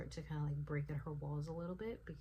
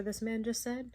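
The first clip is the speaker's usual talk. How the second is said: ordinary delivery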